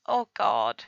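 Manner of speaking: usual speaking voice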